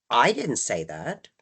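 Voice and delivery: I didn't say that, with didn't slow and rising; didn't drawn out with a rise; stress on didn't. Shown as a demonstration. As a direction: hit the word didn't and stretch it. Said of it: "that implies that somebody else did".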